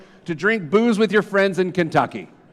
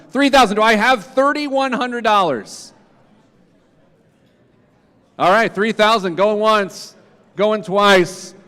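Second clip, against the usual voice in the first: auctioneer. Real, not acted. Closing the sale.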